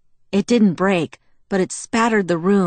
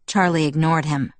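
ordinary delivery, nothing marked

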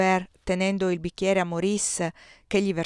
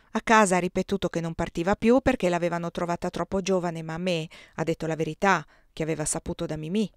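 plain reading voice

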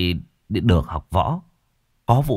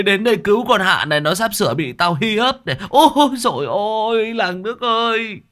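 much higher, loud mock wail of the girl's cries; mocking thug; 12 semitones higher, 6 dB louder than usual. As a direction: ham up her screams to taunt her. Thug mocks girl's cries for help.

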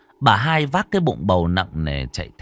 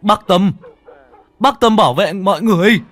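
loud, high-pitched urgent holler; yelling from outside; 11 semitones higher, 7 dB louder than usual. A narrator's reading. Ông Đoàn outside calls to bác Tâm to protect everyone, yelling. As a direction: call out desperately to someone inside the house. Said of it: "đứng ngoài mà gào vọng vào".

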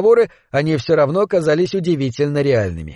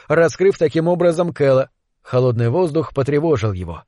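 ordinary delivery, nothing marked

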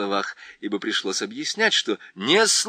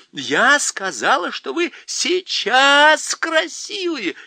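high, slow and loud; insistent girl; 7 dB louder, slower than usual. A narrator's reading. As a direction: spell it out firmly. Lucy insisting. (настойчиво, медленно, высоко)

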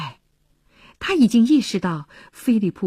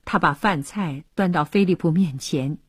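usual speaking voice

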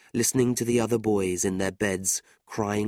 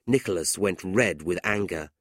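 ordinary delivery, nothing marked

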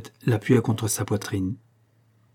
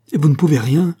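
loud, high, rising demand; desperate question; 5 semitones higher, 7 dB louder than usual. A narrator's reading. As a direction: press the question with a raised voice.

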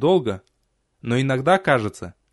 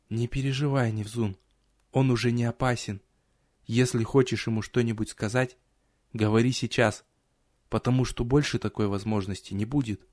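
hushed sly woman; 2 semitones lower, 6 dB quieter than usual. Acted. Hisa speaks to the hero.

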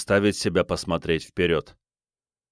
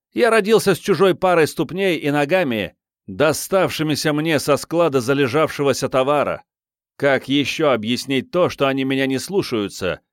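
nervous self-mocking comedian, higher; stand-up comic; 5 semitones higher, 4 dB louder than usual. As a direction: deliver it like a stand-up routine. (комик на сцене)

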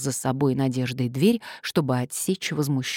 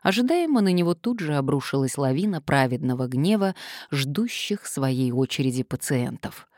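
ordinary delivery

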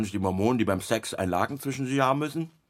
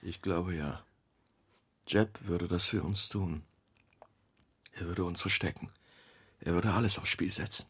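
soft, low, choked up; Mark, moved, admits Jeb would hide them, softly; moved, near speechless; 8 semitones lower, 7 dB quieter than usual. Acted. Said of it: "wirkt vor Rührung ganz sprachlos".

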